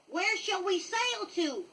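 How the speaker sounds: high, slow eager question; eager cry. A narrator's reading. The lost boys ask Pan where to sail, high and eager. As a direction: call out keenly as a boy. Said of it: "cried the boys".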